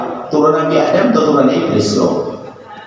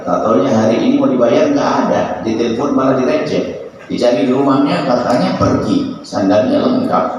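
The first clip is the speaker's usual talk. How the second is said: ordinary talk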